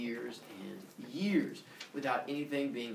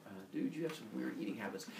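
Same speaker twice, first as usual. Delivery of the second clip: low-key, quick, softer remark; awkward aside; 4 semitones lower, 10 dB quieter, faster than usual. Acted. Zeus remarks on his dad's puked-up rock, quiet awkward aside. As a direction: drop the volume, say it quickly.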